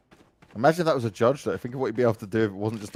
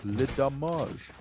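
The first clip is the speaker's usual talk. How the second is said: slow, hammed-up French accent on "Le damage"; a clumsy mock French "Le damage"; deliberately bad French accent; 7 dB quieter, much slower than usual. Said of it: "My French accent's so bad".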